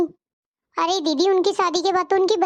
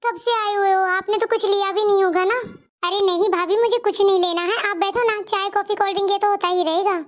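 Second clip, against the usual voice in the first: usual speaking voice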